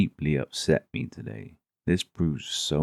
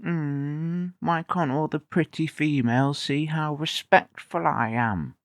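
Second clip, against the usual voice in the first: whiny mock nice guy; 10 semitones higher, 3 dB quieter than usual. Mocking the nice guy's inner thoughts.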